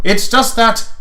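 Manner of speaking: a hint of a grand prince; lightly voiced prince.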